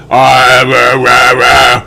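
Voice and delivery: loud, flat chanted robot rah. Retold or imitated. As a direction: bark it out like a clunky robot. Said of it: "They all sound like damn robots".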